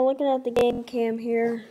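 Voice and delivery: ordinary talk, nothing marked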